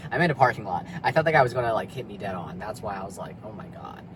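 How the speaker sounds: usual speaking voice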